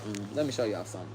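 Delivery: low, soft and quick, teasing; knowing aside. Retold or imitated. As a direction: drop low and hush it like a secret. Answering inmates who call her crazy.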